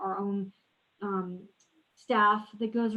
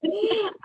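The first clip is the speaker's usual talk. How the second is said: high laugh; 12 semitones higher, 3 dB louder than usual. Real, not acted. Host laughs at short answer.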